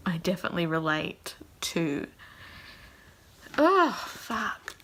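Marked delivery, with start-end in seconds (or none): 3.6-4.8 real sudden tearfulness; choking up. Real, not acted. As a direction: voice cracking high with emotion. Voice catches while talking.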